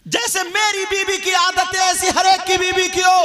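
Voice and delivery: high, loud, crying out; poor husband praising his wife, loud and high; fervent praise. Retold or imitated. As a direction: shout the blessing for such a wife.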